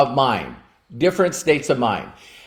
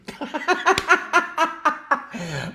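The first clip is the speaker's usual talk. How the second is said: high laugh; 13 semitones higher, 4 dB quieter than usual. Real, not acted. Laughing at his wife's answer, high burst of laughter.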